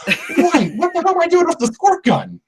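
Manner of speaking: high-pitched comic bafflement; baffled, squeaky-high complaint; exasperated rant. Acted. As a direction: pitch way up in mock disbelief. Comic rant about emoji messages.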